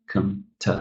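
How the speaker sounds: low, soft "com-, t"; unstressed syllables sunk low and short; low pitch. Shown as a demonstration. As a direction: drop "com-" and "t" down quietly. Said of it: "The pitch is quite low".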